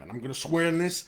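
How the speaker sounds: ordinary talk, nothing marked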